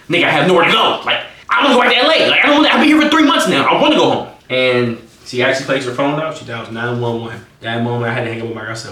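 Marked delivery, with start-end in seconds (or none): 0.1-4.3 loud, high, pleading outburst; exasperated plea. Retold or imitated. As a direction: build to a raised, rushed plea. Acted plea to go home.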